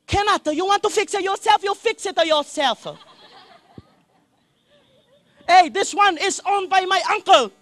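high, loud mock Chinese shop lady; Chinese-accented lady telling a customer she can't fix the battery. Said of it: "owned by a Chinese lady".

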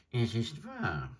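high, croaking bird voice; a scornful old stork sneering; adjutant bird. Acted. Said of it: "behauptete der große Vogel".

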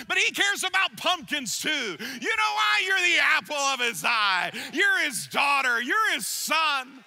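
loud, high, building exhortation; fervent preaching. Acted. Pastor tells the congregation God cares, loud and fervent. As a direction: lift the whole church with it.

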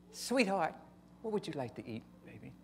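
soft, sweet, imitating his wife; sweet wife imitation. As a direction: coo it gently, like a suddenly sweet wife. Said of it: "I mean, real sweet".

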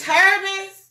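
high, loud excited shout-out; hyped-up echo. Acted. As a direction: pump up the product name.

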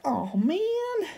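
high, soft, whiny little lament; imitating her own letdown at the burnt project; mock dismay. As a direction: pout it out like a sulky child. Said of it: "I was just like".

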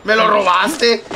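loud, slow, outraged accusation; indignant shout. Real, not acted. Teammate stole his kill.

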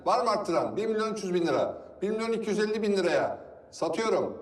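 fast, rhythmic auction calling; auctioneer. Acted. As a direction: call out bids like an auctioneer.

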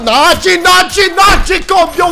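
loud, rising cheers; excited cheering. Real, not acted. Cheering his character on, loud and excited. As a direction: hype yourself up as the combo lands.

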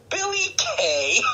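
very high, stretched-out name announcement; hammed-up reveal. Host naming the Rumble's MVP, gleeful hammed-up announcement. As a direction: draw the name out like a big reveal.

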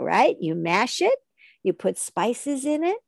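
ordinary talk, nothing marked

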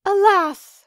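high, loud wail of despair; weeping maiden. A narrator's reading. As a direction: cry out alas in distress. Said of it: "said she".

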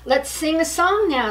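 rally everyone to sing; eager call. Acted. Badger eager suggestion.

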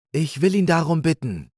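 high, clear, determined doe. A narrator's reading. Ulla offers to fetch Nemo in a clear high voice. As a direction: speak up clearly and bravely. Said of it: "sagte Ulla mit klarer Stimme".

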